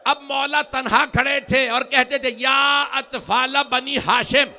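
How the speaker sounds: slow, loud, high mournful chant; grieving lament. Acted. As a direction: draw out each word in grief. Grieving recitation of Imam's lament. (آہستہ، بلند، پُرسوز نوحہ)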